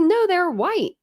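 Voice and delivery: answering the troll at the pool, high and incredulous; incredulous correction. Retold or imitated. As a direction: correct her, baffled. Retold argument with troll.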